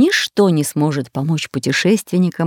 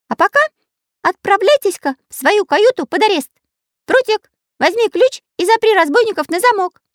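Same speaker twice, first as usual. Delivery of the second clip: Karandash's voice. A narrator's reading. Karandash orders arrest.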